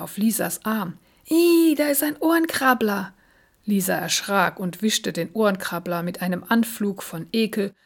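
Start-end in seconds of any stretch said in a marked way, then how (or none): 1.3-3.1 much higher, louder startled squeal; disgusted shriek. A narrator's reading. Sophie spotting an earwig on Lisa's arm, shrieking higher. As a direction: squeal as if a bug is on you. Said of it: "Da schrie plötzlich Sophie auf".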